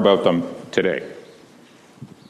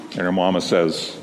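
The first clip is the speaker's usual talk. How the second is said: ordinary delivery, nothing marked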